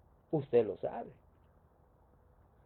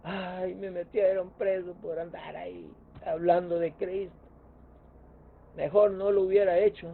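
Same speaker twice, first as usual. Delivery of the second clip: mock whining; 3 semitones higher, 5 dB louder than usual. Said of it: "no estaba llorando ni quejándose".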